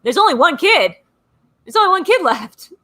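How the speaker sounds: emphatic, rising exasperation in a retold reply; exasperated retort. Grandmother setting her muddled son straight, exasperated. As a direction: spell it out to someone being dense.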